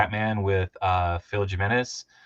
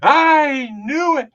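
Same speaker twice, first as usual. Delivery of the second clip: much higher, loud, slow gloating; mock triumphant professor; 8 dB louder, much slower than usual. Professor catching them out, mock triumphant. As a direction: draw out a smug victory. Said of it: "he'd be like".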